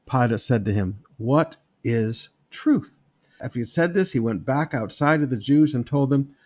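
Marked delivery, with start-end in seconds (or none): 1.2-3.0 drawn out, lower question; slow weary question. Acted. Pilate dismissing Jesus, slow and weary. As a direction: let it hang, tired.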